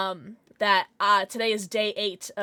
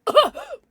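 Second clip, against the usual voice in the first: sharp gasp; 10 dB louder than usual. Real, not acted. Sharp gasp while drinking.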